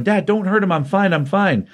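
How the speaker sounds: mock-urgent kid begging his dad to stop; pleading son. Superboy begging his dad not to hurt Batman, high mock plea.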